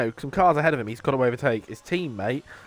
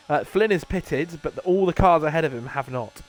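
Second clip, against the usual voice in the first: normal talking voice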